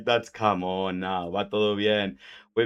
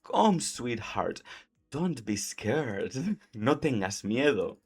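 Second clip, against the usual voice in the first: softer, very slow and gentle; soothing; 5 dB quieter, slower than usual. Shown as a demonstration. Teacher demonstrating the gentle invitation, soothing. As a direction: coax someone frightened.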